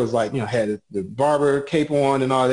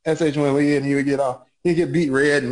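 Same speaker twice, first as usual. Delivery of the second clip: ordinary talk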